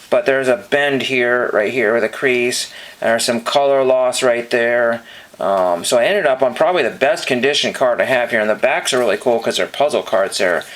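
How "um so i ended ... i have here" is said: ordinary delivery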